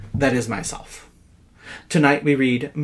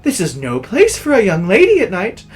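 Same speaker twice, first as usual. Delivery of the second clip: imploring; 8 semitones higher, 7 dB louder than usual. Acted. Man imploring the crying woman.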